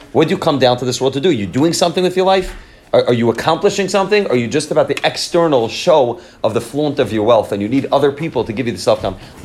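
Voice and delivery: ordinary talk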